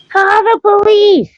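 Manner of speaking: high, loud, slow mock shriek for help; hammed-up cry for help from behind the door; mock panicked scream. Girl being chased by her mother inside, a mock screaming plea for help. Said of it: "and Cindy goes".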